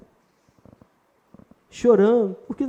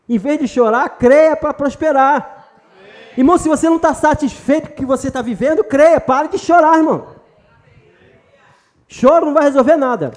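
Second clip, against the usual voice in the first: louder, higher preaching push, slowing on key words; fiery exhortation; 4 semitones higher, 4 dB louder than usual. Real, not acted. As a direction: urge them hard to stop crying and believe. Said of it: "pastor exhorts the congregation to believe".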